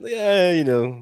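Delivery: mock-casual, like it's nothing at all, stretched out; nonchalant. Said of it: "He's all nonchalant about it".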